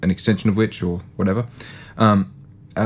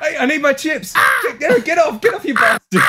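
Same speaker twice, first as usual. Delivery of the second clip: high shout at a thieving gull. Pretend. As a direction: yell to chase the bird off. Shooing seagull off his chips.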